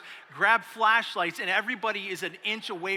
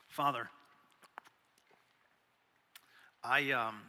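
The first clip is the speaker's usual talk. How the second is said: prayer; 6 semitones lower, 6 dB quieter than usual. Acted. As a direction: pray quietly to God. Begins prayer.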